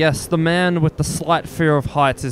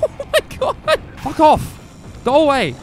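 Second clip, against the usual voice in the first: much higher, frantic and panicked; 11 semitones higher, slower than usual. Real, not acted. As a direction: yell at the monster in panic. Zombie chase.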